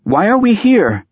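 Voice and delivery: high, insistent character voice; demanding. A narrator's reading. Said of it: "she demanded".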